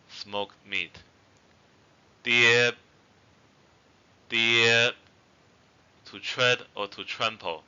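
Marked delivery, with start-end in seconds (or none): 2.2-4.9 "Deer" held long and loud on a level tone; long vowel. Shown as a demonstration. Teacher giving a long-vowel example, drawn-out "Deer". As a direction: stretch the vowel of "Deer", don't release the end. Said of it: "examples with long vowels".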